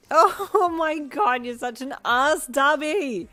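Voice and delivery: high, loud laughing outburst. A narrator's reading. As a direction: shriek it through laughter. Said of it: "you cried with laughter".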